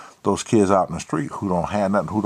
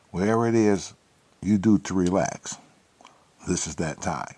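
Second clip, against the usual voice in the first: hushed host voice, inviting people to unwind; smooth show intro; 3 semitones lower, 6 dB quieter than usual. Acted. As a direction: ease the listeners in like a night-time DJ. Late-night show opening.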